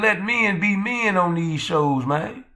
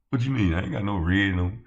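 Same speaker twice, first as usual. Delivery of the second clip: low mock denial; 8 semitones lower, 4 dB quieter than usual. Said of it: "imagined D Free answering".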